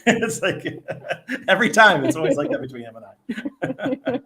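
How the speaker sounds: amused talk through real laughter; high, loud bursts of laughing speech. Real, not acted. Joking to Kitty about livestream handoffs, laughing.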